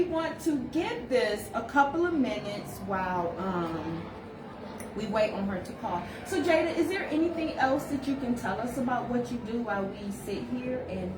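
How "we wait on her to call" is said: ordinary delivery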